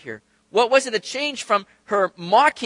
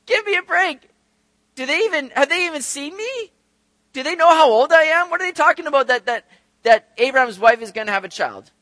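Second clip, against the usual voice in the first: high-pitched, laughing disbelief; incredulous scoffing; 7 semitones higher, 5 dB louder than usual. Retold or imitated. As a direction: scoff as if it's too improbable to believe. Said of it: "all that she could do was just laugh".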